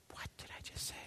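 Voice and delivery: whispered, acted disbelief; stunned whisper. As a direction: whisper it to yourself, aghast. Stunned aside after leaving voicemail.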